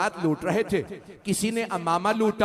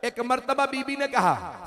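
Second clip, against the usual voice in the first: normal talking voice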